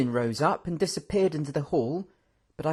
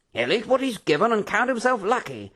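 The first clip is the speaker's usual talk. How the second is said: gruff, dismissive officer; stern general; 9 semitones higher than usual. A narrator's reading. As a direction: bite off each word coldly.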